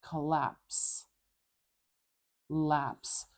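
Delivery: "collapse" then "lapse" split off on its own, slow; split syllables. Shown as a demonstration. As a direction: pull "lapse" out of the word. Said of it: "You can see the word lapse there".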